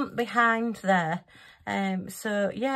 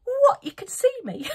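very high, loud shocked squeak breaking into a laugh; a cat caught out while invisible; 5 dB louder than usual. Retold or imitated. The cat thinking its cloak hid it, shocked squeal. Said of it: "And she said".

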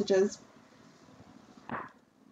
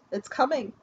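ordinary talk

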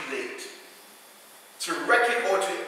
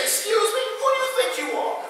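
much higher, fast, offended "excuse me"; indignant attitude; 14 semitones higher than usual. Shown as a demonstration. Preacher acting out a stranger stepping on his foot, indignant and high. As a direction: play someone whose foot just got stepped on.

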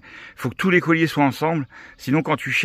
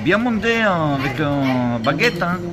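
higher, clipped mock Chinese waiter French; imitating a Chinese restaurant host, a bit higher; caricatured Chinese-accented French, lightly pitched up; 3 semitones higher, slower than usual. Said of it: "il parle vraiment comme ça".

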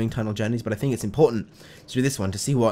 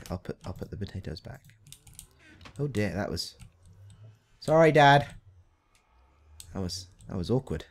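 usual speaking voice

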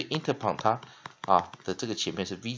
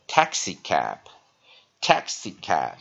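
"taxi cab" slow, first and last syllables stressed; short-weak-short stress; 4 semitones lower, 5 dB louder, much slower than usual. Shown as a demonstration. Teacher modelling the pattern, "taxi cab" slow with short-weak-short stress. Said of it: "它是short，weak，short的组合".

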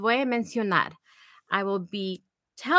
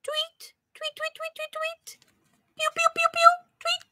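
bird chirps. Shown as a demonstration. Librarian chirping like a little bird for the children. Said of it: "How does the bird sound?".

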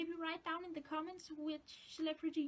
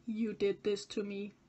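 imagined accusation; 4 semitones lower, 9 dB louder than usual. Retold or imitated. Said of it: "looking at me like".

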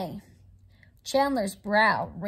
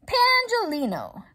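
high excited call of the name; cried out; 9 semitones higher than usual. A narrator's reading. Bismarck crying out to cheer on his friend. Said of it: "Bismarck cried".

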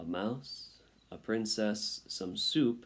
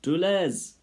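put-on high, loud French-accented call; French mother calling; 9 semitones higher, 8 dB louder than usual. A narrator's reading. Mother calls one of her sons, high French-accented cry. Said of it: "gasped aloud".

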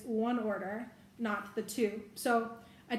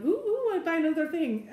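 higher, mock-excited self-imitation; shopping frenzy; 6 semitones higher, 3 dB louder than usual. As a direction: act out getting carried away by shopping. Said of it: "I was just in a frenzy".

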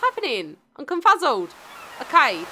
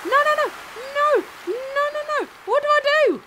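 high-pitched, rushing by the end; flustered panic; 13 semitones higher, faster than usual. Real, not acted. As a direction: panic at the controls. Flustered during fishing minigame.

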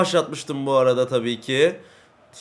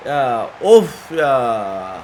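mock crying; 3 semitones lower, 4 dB louder, much slower than usual. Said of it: "Nasıl rol yaptım ağlama rolü?".

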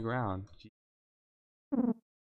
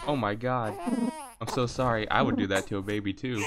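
normal talking voice

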